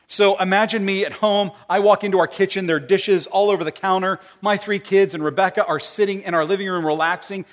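usual speaking voice

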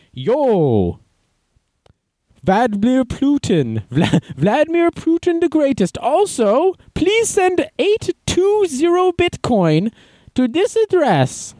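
high, slow put-on hacker voice; hammed-up high Russian hacker, drawn out; mock Russian hacker.

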